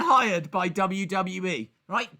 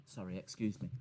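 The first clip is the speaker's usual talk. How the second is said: polite mumble; 11 semitones lower, 15 dB quieter, faster than usual. Pretend. Polite excuse before smashing his face.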